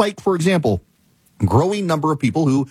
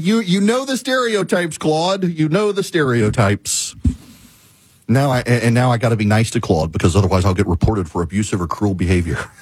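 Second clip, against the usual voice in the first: ordinary talk, nothing marked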